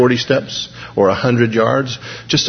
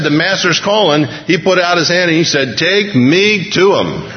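higher-pitched, fervent sermon delivery; lifted, urgent preaching tone; impassioned preaching; 6 semitones higher than usual. Acted. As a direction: drive the point home to the congregation. Preacher raises intensity.

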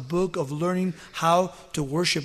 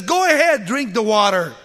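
angry; 3 semitones higher, 8 dB louder than usual. Acted. Moses shouting at the thirsty people, angry command. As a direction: hurl it at the crowd. Moses striking rock in anger.

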